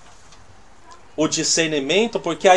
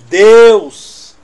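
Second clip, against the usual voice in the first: booming, higher "Deus" like a bold prophet; mock proclamation; 3 semitones higher, 12 dB louder than usual. Preacher mocking a bold prophecy to a stranger, booming. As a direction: throw out "Deus" as a grand announcement.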